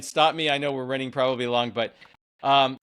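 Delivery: ordinary talk, nothing marked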